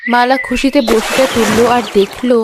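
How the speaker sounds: low, calm storyteller voice; story narration. A narrator's reading. Narrator telling the story to the audience, calm storytelling. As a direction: tell it evenly like a folk tale.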